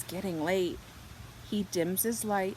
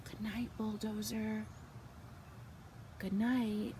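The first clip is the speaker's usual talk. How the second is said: soft bedtime voice; 9 dB quieter than usual. A narrator's reading. Bedtime goodnight.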